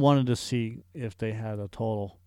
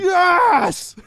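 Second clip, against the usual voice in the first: very high, very loud shout; character scream; 11 dB louder than usual. Acted. Host responding to cartoon hero's name, screamed. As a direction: belt it out as a long yell. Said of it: "be able to scream in his voice".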